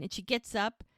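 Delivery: ordinary talk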